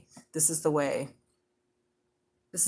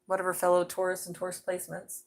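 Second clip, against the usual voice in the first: ordinary talk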